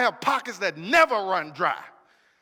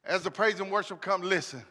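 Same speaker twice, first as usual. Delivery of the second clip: much softer, lower, intimate preacher; quiet pastoral close over worship; soft closing exhortation; 4 semitones lower, 7 dB quieter than usual. Acted. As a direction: ease the room into worship.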